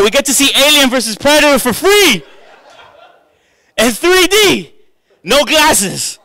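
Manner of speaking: high, loud excited call; excited calling wife. Retold or imitated. Husband calling his wife to watch the fight, excited. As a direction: call the wife over, thrilled. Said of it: "I'm like".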